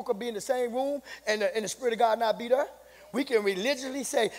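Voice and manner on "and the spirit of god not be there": ordinary talk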